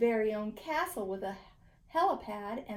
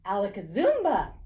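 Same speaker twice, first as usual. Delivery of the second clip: loud magic incantation; 8 dB louder than usual. A narrator's reading. Boy casting his magic word, loud and proud. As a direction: shout the magic word with a flourish.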